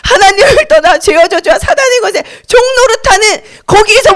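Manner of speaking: high, mock pleading; hammed-up high plea; clueless crowd crying. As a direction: imitate people wailing without understanding. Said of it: "무슨 말 하는지도 모르고 외쳤어".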